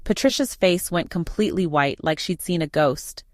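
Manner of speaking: usual speaking voice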